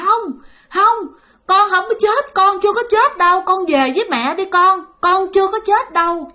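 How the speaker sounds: high-pitched, mildly tearful denial; acted motherly plea, high and insistent; retold tearful pleading. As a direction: imitate a mother pleading through tears, pitched well up. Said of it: "vừa khóc vừa nói".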